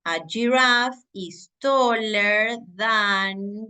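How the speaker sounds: slow, careful English example; teacher modelling a sentence slowly; slow model sentence. Shown as a demonstration. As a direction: stretch it out for the class. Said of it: "voy a decir".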